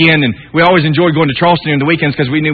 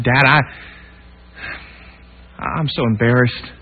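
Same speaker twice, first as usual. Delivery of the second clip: softer, choked-up confession; near tears; 4 semitones lower, 6 dB quieter than usual. Retold or imitated. Son confessing to dad, softly near tears. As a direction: speak as the tears well up. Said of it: "tears started welling up in my eyes".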